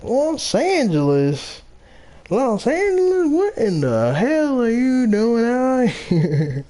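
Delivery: squealing mock Southern accent, stretched out; incredulous Southern accent. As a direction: ham up a shocked Southern cop repeating Los Angeles. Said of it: "deep Southern accents".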